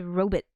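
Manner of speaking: "robot" ending like "bit"; old-fashioned pronunciation. Shown as a demonstration. Streamer showing how they said it in the past, "robot" as ROH-bit. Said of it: "like B I T".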